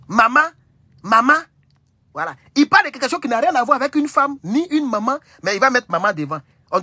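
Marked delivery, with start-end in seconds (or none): 0.1-1.4 put-on Cameroonian accent. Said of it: "Ça, c'est Cameroun".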